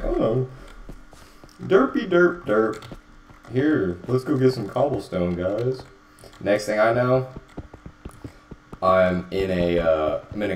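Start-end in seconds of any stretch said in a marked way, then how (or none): 0.0-5.8 goofy high dopey voice; derpy voice. Retold or imitated. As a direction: play a clueless goof. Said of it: "was like".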